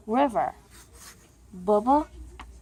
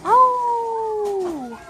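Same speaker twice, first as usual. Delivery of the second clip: howl; 12 semitones higher, 3 dB louder than usual. Acted. Reading that the dogs howl.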